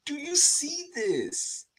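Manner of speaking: high, slow, spoken aloud in wonder; voiced amazement. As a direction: ask it aloud, still amazed. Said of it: "I'm like".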